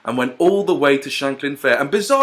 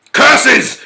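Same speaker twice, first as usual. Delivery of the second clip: loud, much higher cry of defeat; cried out; 11 semitones higher, 9 dB louder than usual. Acted. Big bad wolf crying out at his defeat. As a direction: fling out the curse in frustration. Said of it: "cried the big bad wolf".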